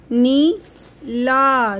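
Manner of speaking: Neela with the नी held long; slow stretched long ई. Shown as a demonstration. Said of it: "आवाज़ थोड़ी सी लंबी". (नीला की नी लंबी खींचना)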